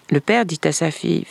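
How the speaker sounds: ordinary delivery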